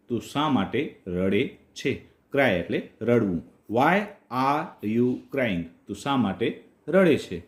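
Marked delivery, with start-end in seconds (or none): 3.7-5.6 very slow, word by word; very slow repeat. Shown as a demonstration. Teacher repeating the friends' question very slowly for learners. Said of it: "repeated slowly for learners".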